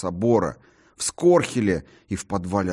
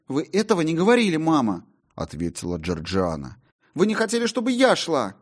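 retorting daughter; 9 semitones higher, faster than usual. Acted.

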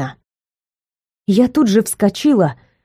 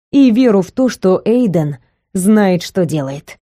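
ordinary delivery, nothing marked

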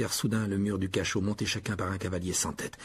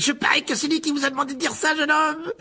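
very high, projected accusation; Sir Patrick crying out; 5 dB louder than usual. A narrator's reading. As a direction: call it out tauntingly, pitch way up. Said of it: "s'écria la tête de Sir Patrick". (exclamation railleuse très aiguë)

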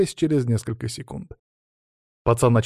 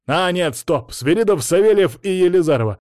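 raised, higher-pitched roll call; calling out names; 7 semitones higher, 5 dB louder than usual. A narrator's reading. Teacher halts three pupils, calling out sharply. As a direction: stop them and call the names loudly.

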